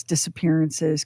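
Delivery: ordinary talk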